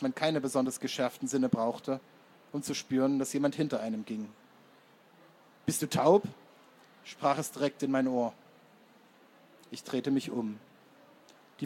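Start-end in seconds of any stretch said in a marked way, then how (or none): 5.7-6.2 gereizte Frage. A narrator's reading. Verfolger fährt den Erzähler an.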